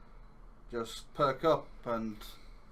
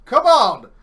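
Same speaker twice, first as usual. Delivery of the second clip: loud, high commanding call; urgent in-character battle cry, building louder; rallying shout; 9 semitones higher, 14 dB louder than usual. Acted. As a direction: shout at a cowering man to get moving. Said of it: "He says".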